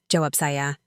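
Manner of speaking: usual speaking voice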